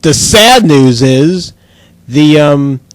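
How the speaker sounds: ordinary delivery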